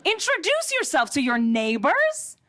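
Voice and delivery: incredulous. Acted. Appalled by the citizenship test's answer, incredulous. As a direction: scoff at the idea in disbelief.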